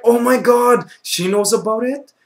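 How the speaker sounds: high-pitched, stunned realization; retold shock. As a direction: gasp it out in mock alarm. Retold shock at the stewardess's look.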